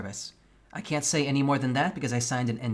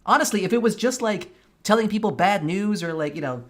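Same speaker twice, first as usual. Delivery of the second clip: loud, much higher, exasperated; heated rant; 9 semitones higher, 8 dB louder than usual. Real, not acted. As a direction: push back hard, almost shouting. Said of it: "I am so fucking pissed".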